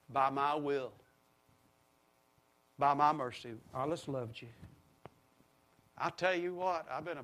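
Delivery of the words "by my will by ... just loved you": tender voice of God. Retold or imitated. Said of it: "Look what he said".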